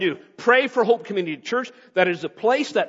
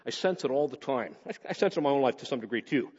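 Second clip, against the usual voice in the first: ordinary talk, nothing marked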